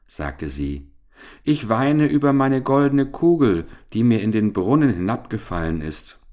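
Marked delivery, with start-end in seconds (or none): 1.4-6.2 high, loud complaint at first, easing off; mildly acted wail, narrated; lamenting princess. A narrator's reading. Princess tells the frog about her lost golden ball, lamenting. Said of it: "wie sie so klagte".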